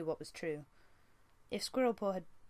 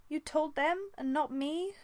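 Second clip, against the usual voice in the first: high, louder hurt wail; 10 semitones higher, 4 dB louder, slower than usual. Acted. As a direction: cry out feeling betrayed. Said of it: "she wailed".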